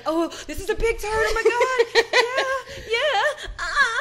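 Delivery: high, hammed-up pleasure moaning; mock pleasure moans. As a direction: moan it like a big turn-on.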